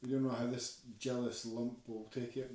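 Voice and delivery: ordinary delivery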